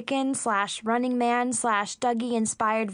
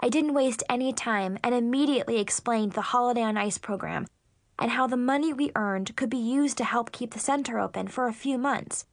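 plain reading voice

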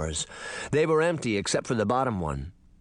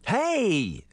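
complaining; 9 semitones higher than usual. Acted. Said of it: "she complained".

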